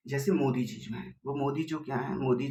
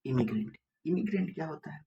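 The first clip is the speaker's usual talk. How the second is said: ordinary talk, nothing marked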